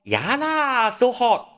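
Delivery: high, loud Malaysian lah; Malaysian colloquial. Shown as a demonstration. Explaining Malaysian lah to viewers with a high, loud Manglish demo. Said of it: "And you can say".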